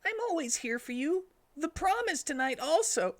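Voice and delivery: very high, sweet reassurance; Tails voice. Acted. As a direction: comfort your friend in a squeak. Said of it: "Tails hugged Sonic and said".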